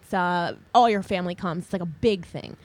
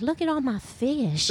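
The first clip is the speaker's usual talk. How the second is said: breathy hollywood starlet; 4 semitones higher than usual. Pretend. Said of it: "exactly how Jesus sounded".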